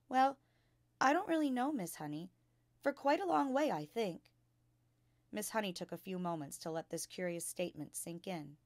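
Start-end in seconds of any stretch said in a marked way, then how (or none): 0.1-4.3 lightly voiced small girl, higher and unsure; little girl Matilda. A narrator's reading. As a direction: answer modestly like a little girl. Child Matilda answering.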